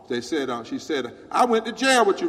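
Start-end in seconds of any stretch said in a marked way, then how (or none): 1.3-2.3 louder, higher proud claim; loud mock-proud lady; retold exclaim. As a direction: tell him proudly, raised voice. Lady's retold speech.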